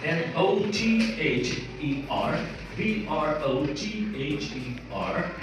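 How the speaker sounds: letters spelled out slowly, one by one; patient teacher spelling the word out slowly. Shown as a demonstration. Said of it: "like we say".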